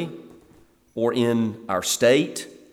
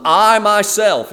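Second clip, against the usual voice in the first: "I myself" punched high and loud, drawn out slowly; preacher stressing "I myself" to show the Hebrew emphasis; emphatic I myself; 8 semitones higher, 6 dB louder, slower than usual. Shown as a demonstration. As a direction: hit "I" hard and stretch "myself". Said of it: "there's this emphatic in the Hebrew".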